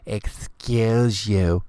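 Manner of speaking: slow, haughty rising "Excuse you"; drawn-out stuck-up put-down; snotty "excuse you". Retold or imitated. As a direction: sneer it like a prissy girl. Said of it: "people walking around going".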